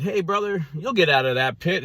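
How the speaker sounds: high-pitched, cheerily optimistic passer-by; upbeat mock well-wisher.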